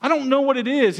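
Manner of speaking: a bewildered complaint thrown upward; frustrated. Retold or imitated. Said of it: "I got so frustrated 'cause I was like".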